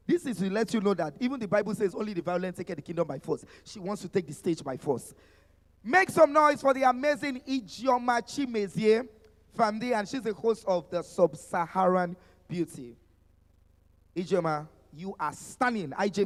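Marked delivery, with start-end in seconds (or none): 5.8-9.9 higher, louder emcee intro; hype call. Real, not acted. Emcee introducing the moderator to the crowd, rising hype. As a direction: get the crowd cheering for her.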